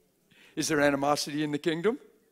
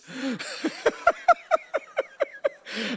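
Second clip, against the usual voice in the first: big high laugh; 6 dB louder than usual. Real, not acted. Laughing at his own story.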